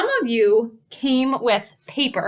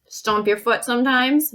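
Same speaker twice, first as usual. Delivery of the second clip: ordinary talk, nothing marked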